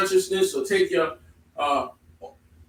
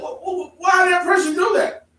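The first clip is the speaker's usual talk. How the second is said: puzzled bystander; 11 semitones higher, 6 dB louder than usual. Retold or imitated. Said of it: "you're like".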